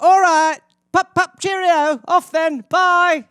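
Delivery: high, loud British sign-off; hammed-up cheery British goodbye, sung out high; put-on British accent. Ending a family phone call, exaggerated British accent. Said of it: "I'll get off the phone".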